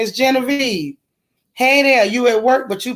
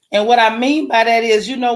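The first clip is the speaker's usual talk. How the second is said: ordinary talk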